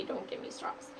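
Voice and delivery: soft whispered aside; whispered quote. Retold or imitated. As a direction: breathe it as a hushed quote. Quoting request to takeout staff.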